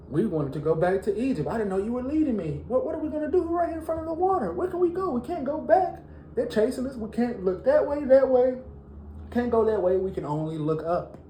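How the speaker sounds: cornered at the water with nowhere to run; panicked Israelites. Acted. Preacher acting the trapped Israelites, panicked and rushed.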